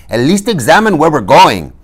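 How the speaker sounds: high insistent protest; urgent Inigo. A narrator's reading. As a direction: plead loudly for caution. Inigo calls after departing Fezzik.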